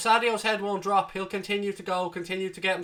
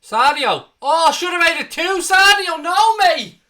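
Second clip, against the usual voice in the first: real groaning shout, much higher and louder; anguished yell; 11 semitones higher, 11 dB louder than usual. Real, not acted. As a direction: howl at the miss in disbelief. Near miss, live reaction.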